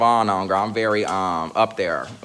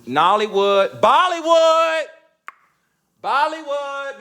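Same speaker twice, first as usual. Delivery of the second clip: the word suddenly comes, so shout it slowly and gleefully; excited exclaim; 13 semitones higher, 6 dB louder, slower than usual. Real, not acted. To friends, finally remembering it, calls out excitedly. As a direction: stretch Bollywood out high and loud.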